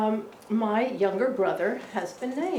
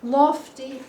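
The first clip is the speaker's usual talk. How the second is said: usual speaking voice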